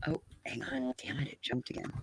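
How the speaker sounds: whispered aside under her breath; whispering. Real, not acted. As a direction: drop to a whisper off-mic. Muttering aside to herself.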